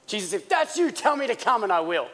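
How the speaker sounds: high call easing down at the end. Acted. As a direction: start high, then settle. Peter's actual words, acted.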